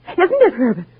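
usual speaking voice

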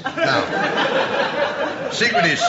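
high breathy giggling voice; camp giggle. Acted.

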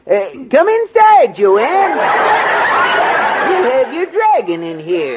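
high squeaky elf voice. Pretend. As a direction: pitch it way up like a tiny elf. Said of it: "Use an elf's voice".

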